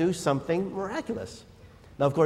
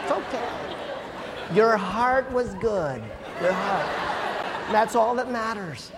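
gentle Pastor Wayne; 5 semitones higher, slower than usual. Retold or imitated. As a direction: coo it like an overly gentle pastor comforting his team. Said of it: "He'd probably say something like".

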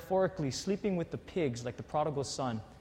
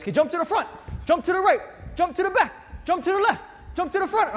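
much higher, loud barked commands; trainer commands; 13 semitones higher, 5 dB louder, faster than usual. Retold or imitated. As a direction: call the moves like a DVD coach. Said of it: "And he goes".

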